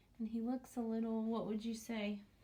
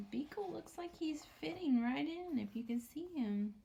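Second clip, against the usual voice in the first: ordinary delivery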